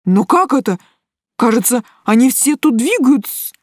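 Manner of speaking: a touch of panic as realisation dawns; frightened guy struggling to believe it; suddenly hoarse alarm. A narrator's reading. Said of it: "Его голос вдруг осип".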